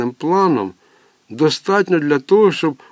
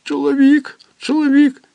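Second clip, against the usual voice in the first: put-on very high, louder, panicked child; terrified cry; 13 semitones higher, 4 dB louder than usual. Boy clinging to mother, terrified high cry.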